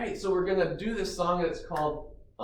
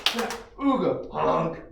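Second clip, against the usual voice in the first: goofy ooga and honk noises; silly clown. Shown as a demonstration. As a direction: play it like a clown horn. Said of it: "fun song motions and sounds".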